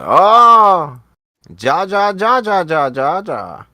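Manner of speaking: loud, high-pitched mock outburst; mocking Dame impression. Host mocking Dame's sudden switch, loud high jeering. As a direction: shout it high, as repeated nonsense syllables. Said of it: "now he's like".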